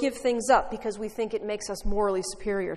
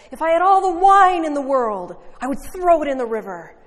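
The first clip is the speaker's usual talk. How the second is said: louder, higher fiery preaching; fired-up preacher; 5 semitones higher, 10 dB louder than usual. Retold or imitated. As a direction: really fire up the crowd. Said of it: "And getting more fired up".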